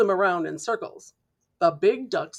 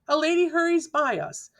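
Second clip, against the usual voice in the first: ordinary delivery, nothing marked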